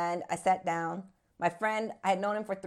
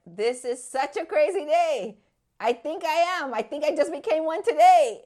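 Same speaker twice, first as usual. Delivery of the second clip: high, increasingly loud retold realisation; amazed excitement; 7 semitones higher, 5 dB louder than usual. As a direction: build to an astonished announcement. Acts out her amazed realisation.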